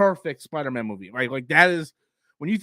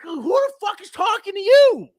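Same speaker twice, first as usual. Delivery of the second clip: high angry shout at the fan; angry retort; 7 dB louder than usual. Real, not acted. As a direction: yell it back in real irritation. Snapping back at the imagined fan.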